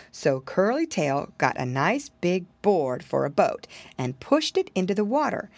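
plain reading voice

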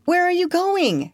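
high, worried question; anxious call, pitched right up; crying out with misgiving. A narrator's reading. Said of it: "she cried with misgiving".

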